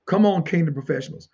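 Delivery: ordinary talk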